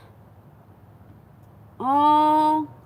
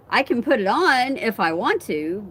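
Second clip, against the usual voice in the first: ordinary talk, nothing marked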